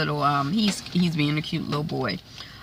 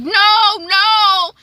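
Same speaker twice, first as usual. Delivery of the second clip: high, loud mock wail; distressed refusal; 14 semitones higher, 7 dB louder than usual. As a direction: imitate a desperate woman crying out in denial. Fanny refusing the news, acted.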